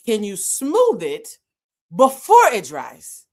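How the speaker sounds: stretched-out, pointed question; exasperated request. Retold or imitated. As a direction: spell it out for him slowly. Story, exasperated request to painter.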